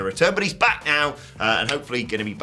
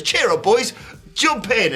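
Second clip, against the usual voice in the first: cheery call; 10 semitones higher, slower than usual. Retold or imitated. Odyssey Jones calling out to the beaten team, high and cheery. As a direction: shout it up high to cheer the lads.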